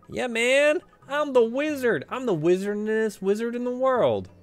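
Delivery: high, loud, show-off bragging; cocky boast. Acted. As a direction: brag like the greatest wizard. Boasting as the wizard.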